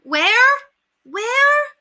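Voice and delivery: very high, loud repeated call; children shouting after her; alarmed child cries. Acted.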